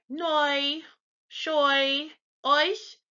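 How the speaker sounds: noi, shoi, euch each drawn out with "oy"; German EU sound. Shown as a demonstration. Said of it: "that oi sound like in boy".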